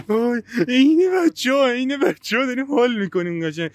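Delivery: high, loud, gleeful burst of fun; excited. Real, not acted. Playing with walkie-talkies like kids.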